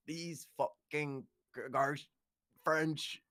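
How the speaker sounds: high, slow, mock-angry German; angry German accent. Philosopher mad at the French, halting angry German accent. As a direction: struggle through an irate German accent. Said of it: "And he'd be angry".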